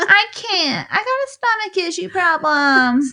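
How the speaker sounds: milk a fake tummy ache; whiny stomach ache. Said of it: "stomach issues".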